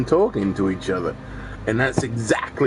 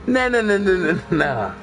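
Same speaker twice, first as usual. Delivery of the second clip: dismissive nah; 7 semitones higher than usual. Real, not acted. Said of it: "It's like".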